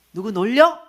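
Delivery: loud, sharp retold scolding; angry retort. Boss angrily asking who she's mocking, loud retort. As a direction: throw the question back at her in anger.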